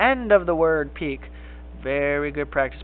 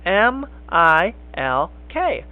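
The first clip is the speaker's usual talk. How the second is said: letters named one by one, x0.5 slow; spelling out slowly; much slower than usual. Shown as a demonstration. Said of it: "there's the word milk".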